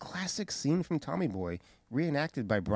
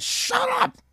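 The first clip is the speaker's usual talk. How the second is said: sharp high shout, much higher; barked shut up; 4 dB louder than usual. Retold or imitated. Regal silencing Adam Cole, imitated sharp shout. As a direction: snap it out like a stern boss. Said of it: "walks up to Adam Cole and says".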